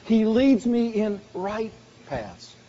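slow, each word leaned on; slowed, deliberate preaching line; slow, weighted delivery. Real, not acted. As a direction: lay it down slowly. Preacher repeats promise slowly.

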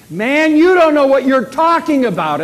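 loud, high, indignant retort; heated denial. Acted. Peter answering his accuser, loud heated denial. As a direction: snap back loudly in protest.

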